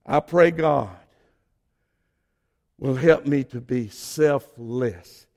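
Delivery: ordinary delivery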